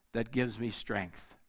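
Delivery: normal talking voice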